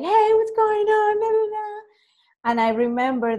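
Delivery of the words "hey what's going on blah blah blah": bright high put-on hello, much higher; squeaky eager hello, pitched way up; high, cheery fake greeting. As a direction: chirp it way up like a nervous eager caller. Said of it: "I was like".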